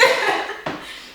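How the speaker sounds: high burst of laughter; amused laugh. Real, not acted. Recalling the teacher's hilarious remark, amused laughter. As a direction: crack up at your own story. Laughs at her own story.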